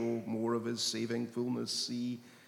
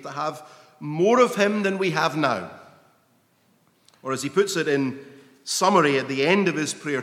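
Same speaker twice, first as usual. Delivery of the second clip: slowed, louder reading of the prayer, stretching key words; fervent scripture reading; 4 semitones higher, 6 dB louder than usual. Acted. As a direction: read Paul's words like a sermon peak. Reading Paul's prayer with fervour.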